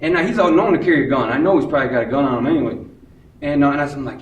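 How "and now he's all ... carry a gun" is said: ordinary delivery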